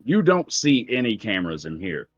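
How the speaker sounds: normal talking voice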